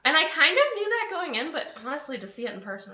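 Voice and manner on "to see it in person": ordinary talk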